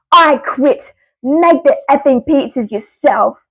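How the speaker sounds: slow, emphatic mock yell; mild acted yell. Fed-up worker quitting at the manager, mild acted yell. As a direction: yell back with each word drawn out, half-restrained. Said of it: "I yell back".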